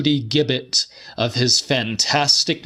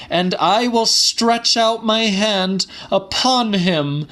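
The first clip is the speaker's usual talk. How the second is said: put-on higher pitched old woman proclaiming scripture; elderly woman calling out; 10 semitones higher than usual. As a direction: cry out the verse as a warning from the pews. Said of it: "called out to Doctor Haxhausen".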